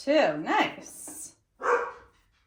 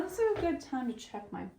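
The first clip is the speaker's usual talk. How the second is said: ordinary talk, nothing marked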